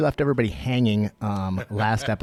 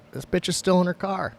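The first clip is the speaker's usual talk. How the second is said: normal talking voice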